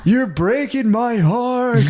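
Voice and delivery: high, slow, loud mock child plea; pleading toddler. Child begging parent, high slow pleading. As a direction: whine it out slowly like a hurt kid. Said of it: "they'll be like".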